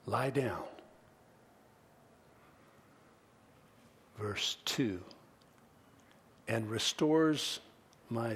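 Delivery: very soft, low, with long pauses; quiet, spacious sermon musing; hushed reflective preaching. Real, not acted. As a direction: ponder it slowly, letting each phrase hang.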